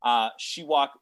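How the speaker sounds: ordinary talk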